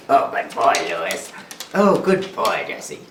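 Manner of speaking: excited dog praise. Real, not acted. Praising dogs.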